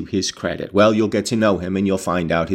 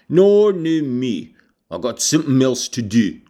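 higher, broad rustic dialect; surly old servant; 3 semitones higher, 3 dB louder than usual. A narrator's reading. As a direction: drag out a rude refusal in thick country dialect. Voicing Joseph's rude dialect reply.

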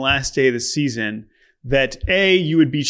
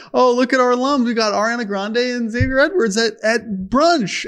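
higher, beaming proud alma mater voice; proud school voice; 9 semitones higher than usual. Retold or imitated. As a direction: gush like a school showing off its famous alums. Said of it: "North Broward's so proud".

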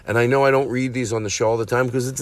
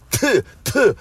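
two high, sharp spitting noises; mock spitting; 11 semitones higher, 4 dB louder than usual. Aristotle scorning the fan mail, imitated spitting. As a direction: spit twice in mock contempt. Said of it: "I spit on the fan mail".

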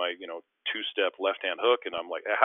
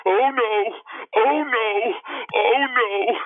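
much higher, loud, crying-style oh no; mock wail; 9 dB louder, much slower than usual. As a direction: wail it in mock despair, hammed up. Running back after dunk.

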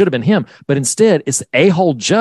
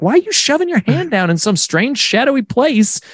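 incredulous; 12 semitones higher than usual. Retold or imitated. Mock exasperated question to character.

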